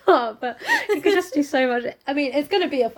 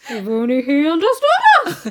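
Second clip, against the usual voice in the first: mock swoop upward on "understood", louder; rising intonation; 3 semitones higher, 4 dB louder than usual. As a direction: send up the rise in "If only he understood us". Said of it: "said the father with rising intonation".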